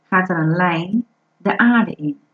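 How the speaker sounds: usual speaking voice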